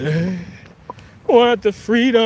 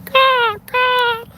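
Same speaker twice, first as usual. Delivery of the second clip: squeal; 4 dB louder than usual. Pretend. High loud exclamation.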